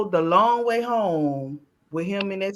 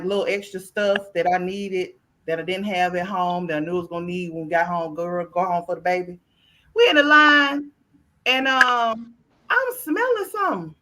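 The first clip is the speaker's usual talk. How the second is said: usual speaking voice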